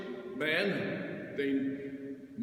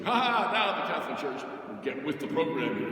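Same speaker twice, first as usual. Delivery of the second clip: gloating laugh, a bit louder; mock the smug critics; 4 dB louder than usual. Priest mimicking critics, laughing smugly. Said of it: "people were saying".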